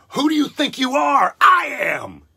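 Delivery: high, loud furious yell at the heckler; shouted retort. Retold or imitated. Bowler yelling at the heckler after winning, shouted retort. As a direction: bellow it in triumph. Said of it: "He just says".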